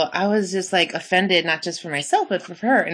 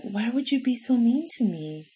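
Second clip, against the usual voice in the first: high, soft pleading, imitating a wounded guy; mock hurt; 7 semitones higher, 4 dB quieter than usual. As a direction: play up the poor-me sadness. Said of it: "sad look on their face".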